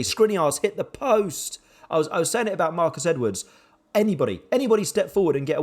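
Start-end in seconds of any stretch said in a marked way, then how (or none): none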